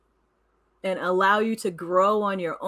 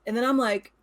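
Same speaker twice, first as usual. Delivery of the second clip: ordinary talk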